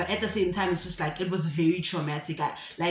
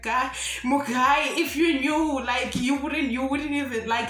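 higher, louder, drawn-out protest; exasperated retort; 4 semitones higher, 4 dB louder, slower than usual. Retold or imitated. Crying girl to joking passenger, loud acted exasperation. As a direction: plead with him, stretching the words.

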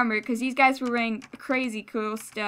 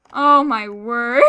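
slow, whiny fake wail; mock crying; 6 dB louder, much slower than usual. Real, not acted. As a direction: wail it out, hammed-up sob. Repeat, drawn out with cry.

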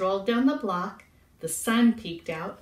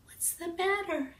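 high, soft little bird voice; bird character; 9 semitones higher, 5 dB quieter than usual. A narrator's reading. Bird asks sad Cat, high soft voice. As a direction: ask softly as a small bird. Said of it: "he asked".

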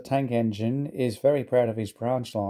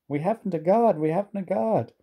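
higher, urgent coach voice; anxious coach; 8 semitones higher than usual. A narrator's reading. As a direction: plead to be heard. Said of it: "Annie tried to tell Thomas".

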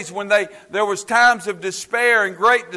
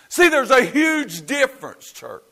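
ordinary talk

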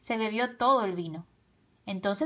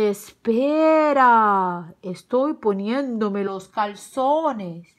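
loud, very drawn-out wolf reply; stalling wolf; 7 dB louder, much slower than usual. A narrator's reading. Tío Lobo stalling behind his door, slow loud drawl. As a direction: bellow it slowly, stretching the vowels.

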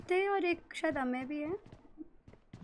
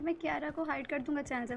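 ordinary delivery

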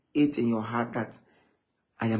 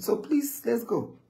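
ordinary talk, nothing marked

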